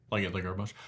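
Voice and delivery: mock [name] stage thanks; [name] thank-you. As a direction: thank the crowd like the King. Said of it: "my bad impersonation".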